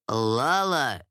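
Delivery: low-pitched man's voice cutting in; low, controlled interruption; interrupting lord. A narrator's reading. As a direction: cut her off in a low lordly voice. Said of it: "перебил Даррен".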